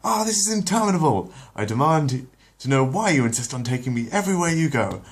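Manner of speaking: put-on high, loud indignant baby voice; a haughty infant demanding answers; Stewie voice. Stewie scolding his mother, high and loud.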